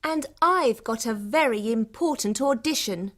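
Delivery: high-pitched, haughty insistence; self-important. Acted. Blonde woman argues for last car, self-important. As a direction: pull rank over the rival.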